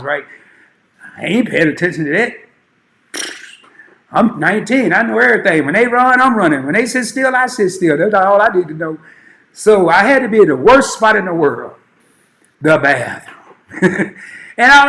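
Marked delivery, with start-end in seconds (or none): none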